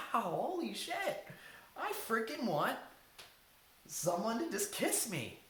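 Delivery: mock excited blurt. Blurting an embarrassing need aloud.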